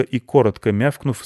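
plain reading voice

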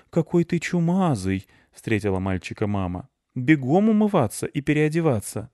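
mother's voice. Acted. Mom scolds dirty boy.

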